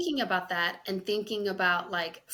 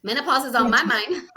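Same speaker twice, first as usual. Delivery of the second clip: higher, louder, through laughter; real laughing; 5 semitones higher, 7 dB louder than usual. Real, not acted. Excusing her mix-up to her co-host, real laughing. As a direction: laugh at your own slip as you speak.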